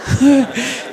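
short, affectionate chuckle; fond laugh. Real, not acted. The speaker laughs fondly at the father signing as tu papá. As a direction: laugh at the memory.

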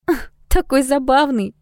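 put-on high, slow gushing girl; delighted exclaim. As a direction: coo over something cute. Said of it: "воскликнула Ода".

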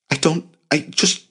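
high, halting, flustered attempt; stammering. A narrator's reading. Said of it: "he stammered".

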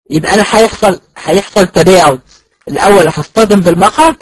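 ordinary talk, nothing marked

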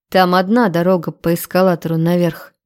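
ordinary delivery, nothing marked

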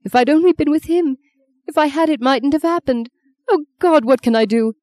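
high-pitched grieving self-reproach; distraught. Acted. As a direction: spill out grief and regret to mum. Bereaved daughter pours out guilt.

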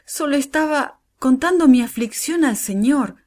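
high, pleading protest; earnest self-defence; exclaiming. A narrator's reading. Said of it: "exclamó".

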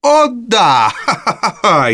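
Nancy breaks into hysterical laughter at Sikes. Acted. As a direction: laugh out of control. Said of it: "истерично расхохоталась Нэнси".

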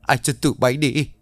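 high, loud, fast screaming threat; furious yelling. A narrator's reading. Ly screams at the thugs leaving, high furious yell. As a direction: scream after them in rage. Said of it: "mà hét lớn".

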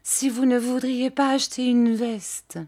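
high, timid question at ordinary volume; timid trembling. A narrator's reading. Frightened seller addresses old shopkeeper, timid and high. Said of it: "dis-je en tremblant".

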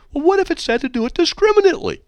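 very high, loud squeaky questioning; mock innocence. Host quibbling with a critic's wording, mock-innocent. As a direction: pitch way up, playfully quibbling over "discriminately". Playfully argues back at hate mail.

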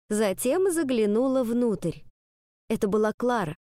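plain reading voice

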